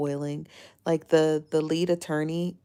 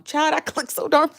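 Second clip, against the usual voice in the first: laughing; 10 semitones higher than usual. Real, not acted. Laughing while ranting about reports.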